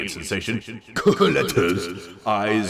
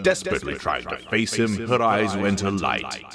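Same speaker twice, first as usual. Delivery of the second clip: ordinary delivery, nothing marked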